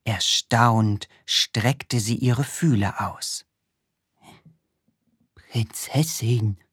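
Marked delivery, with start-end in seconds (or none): 5.3-6.6 whisper. Acted. The snail wakes the princess, whispered slowly. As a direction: breathe the name gently, slowly. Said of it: "flüsterte sie".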